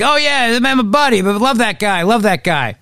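mock chummy gushing, starting high and easing down; hammed-up buddy-buddy praise; Terry McAuliffe. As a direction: imitate a politician fawning over a pal. Mocking McAuliffe praising his buddy.

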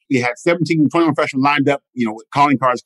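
usual speaking voice

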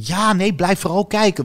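geïmiteerd cliché. Retold or imitated. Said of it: "als mensen zeggen van".

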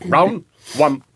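game announcer. Pretend. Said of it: "じゃあ俺が全部言うよ".

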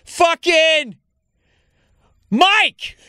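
high drawn-out holler with a long pause; imitated yell. Man calling a forgotten name across a warehouse, mock yelling. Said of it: "he just yells across the warehouse".

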